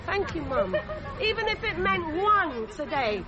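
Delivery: usual speaking voice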